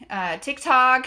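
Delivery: ordinary talk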